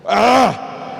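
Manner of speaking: loud, exaggerated "ah" after a drink; thirst-quenched gasp. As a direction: let out a big mock sigh of refreshment. Acts gulping a soft drink down. (¡ah! fuerte y exagerado tras beber)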